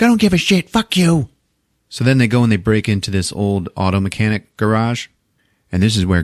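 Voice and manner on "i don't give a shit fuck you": high curt brush-off; mock snapping mom. Sarah rejecting John, high mock snap. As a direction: snap it back.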